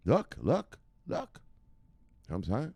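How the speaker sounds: ordinary delivery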